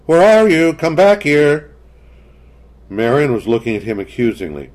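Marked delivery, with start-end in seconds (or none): 0.1-1.7 loud, much higher call into the dark. A narrator's reading. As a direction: call the lost child loudly. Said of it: "he called".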